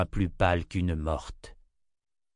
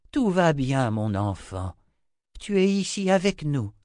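reassuring; 6 semitones higher, 3 dB louder than usual. Acted. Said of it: "la réconforta Zed".

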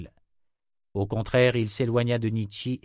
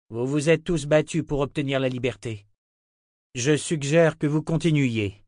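lightly voiced leader, a little higher; rallying leader; 5 semitones higher, 4 dB louder than usual. Richard urges townspeople to keep fighting, mildly rallying. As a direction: address a crowd with measured firmness.

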